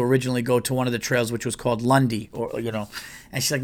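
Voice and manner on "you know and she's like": ordinary talk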